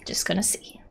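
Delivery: soft whisper; hushed, breath-only aside; whispered repeat. Real, not acted. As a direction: drop to a whisper, as if to yourself.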